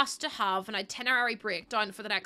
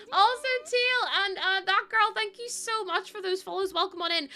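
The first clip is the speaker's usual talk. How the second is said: bright greeting; 10 semitones higher, 4 dB louder than usual. Real, not acted. Greeting new followers on stream.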